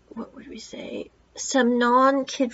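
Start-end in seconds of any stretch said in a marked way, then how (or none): 0.1-1.1 whispered aside to herself, much softer. Real, not acted. Podcaster hunting for a word, whispering to herself. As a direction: breathe it as a private aside. Aside while searching for words.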